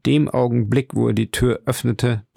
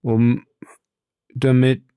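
halting, mildly shaken answer; trembling; 3 semitones higher, 4 dB louder than usual. A narrator's reading. Husband answering his wife's question, trembling and halting. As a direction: break off between words, a touch of trembling. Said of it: "antwortete Caderousse bebend".

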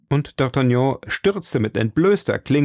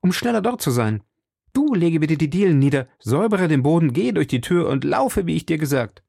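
urgent orders; 6 semitones higher than usual. A narrator's reading. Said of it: "rief D'Artagnan".

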